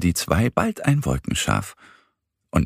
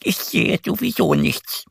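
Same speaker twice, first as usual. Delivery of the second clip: much higher, stubborn duckling voice; sulky duck; 12 semitones higher than usual. A narrator's reading. Annie lies down and insists to Mo, sulky. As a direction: insist sulkily with eyes shut. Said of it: "behauptet die kleine Ente".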